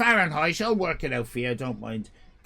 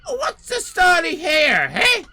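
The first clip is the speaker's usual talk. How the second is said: high, loud indignant shout; angry Italian owner; 11 semitones higher, 7 dB louder than usual. Retold or imitated. Owner shouting at customers about respect. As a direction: yell it like he's been insulted. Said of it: "he says".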